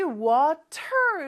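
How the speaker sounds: "water" with a clear T, wa-TER; pronounced hard T. Shown as a demonstration. Teacher shows how learners want to read it, hard T. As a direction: sound out the T in "water" fully. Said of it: "이렇게 발음하고 싶잖아요. T가 있으니까".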